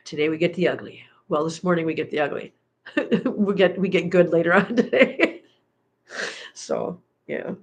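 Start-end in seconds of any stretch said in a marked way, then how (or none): none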